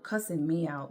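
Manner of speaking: ordinary talk, nothing marked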